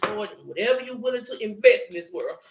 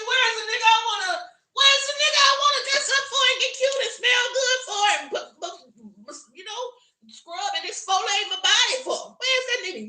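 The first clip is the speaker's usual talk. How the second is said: much higher, louder mocking shriek; squealing mock complaint; 14 semitones higher, 6 dB louder than usual. Mocking women who won't dress up, a high squealing shout. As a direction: squeal it like an exasperated single woman.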